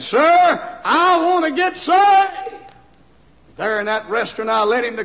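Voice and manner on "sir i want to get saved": high, slow, loud cry to be saved; tearful plea. Retold or imitated. Sobbing businessman begging the preacher, high loud plea. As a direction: cry out through tears.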